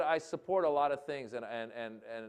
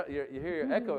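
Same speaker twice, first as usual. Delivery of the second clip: ordinary talk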